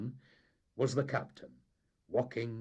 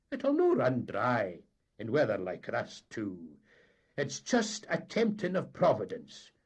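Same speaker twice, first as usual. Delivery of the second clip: raised, earnest Highland voice; persuasive mate; 5 semitones higher, 7 dB louder than usual. A narrator's reading. Mate urging captain to stop at Furness, raised and earnest. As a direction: talk him round with a warning.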